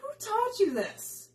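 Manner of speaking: high-pitched mock disbelief; acted, exasperated question; incredulous retort. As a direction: throw it back, voice way up. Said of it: "I'm like".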